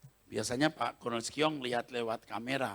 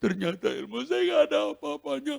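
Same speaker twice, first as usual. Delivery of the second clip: defeated spirit voice; 13 semitones higher, 3 dB louder than usual. Retold or imitated. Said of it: "Terus saya gini".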